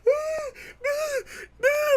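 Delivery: high, broken wailing cries; mock sobbing. Girl catching injured runner, mock sobbing. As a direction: imitate her sobbing in short bursts. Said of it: "She's all crying".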